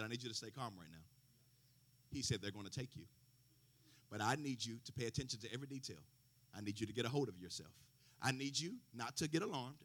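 a low, soft, controlled calm; steady them quietly, like talking down a panicked daughter. Retold or imitated. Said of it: "and he changed".

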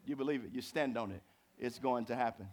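ordinary talk

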